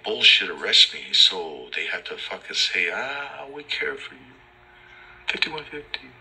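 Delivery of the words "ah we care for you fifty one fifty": higher, softer fake-sweet voice; mock caring. Mocking cops who claim to care, higher soft fake-sweet voice. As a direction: coo it with fake concern.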